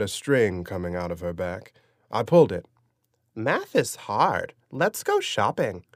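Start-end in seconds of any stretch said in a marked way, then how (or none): 3.4-5.9 high, slow talking-doll chirp; squeaky sing-song doll voice. Acted. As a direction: play it like a pull-string Barbie. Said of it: "in a squeaky sing-song voice".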